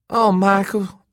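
high, loud cooing reproach; Elena's character voice. A narrator's reading. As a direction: chide him in a high female voice. Elena chides Holt.

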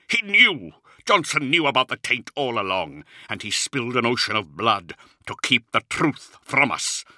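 bitter outburst, bursting out high and loud; vehement. A narrator's reading. Luther accusing Johnson of hiding the taint, vehement. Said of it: "vehemence in Luther's voice".

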